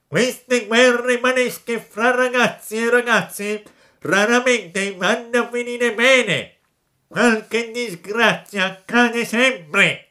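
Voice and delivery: high, loud, slow honking crab; trombone-with-a-cold crab voice. A narrator's reading. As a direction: bellow like a congested trombone. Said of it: "gridò con una vociaccia di trombone infreddato". (vociaccia di trombone infreddato)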